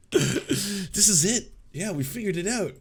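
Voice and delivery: slow, dreamy stoner drawl. Retold or imitated. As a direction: imitate a slow, blissed-out stoner. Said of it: "He sounds like a stoner there".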